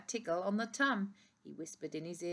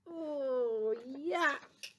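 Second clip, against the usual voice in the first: disgust; 6 semitones higher than usual. Acted. Disgust at the snot.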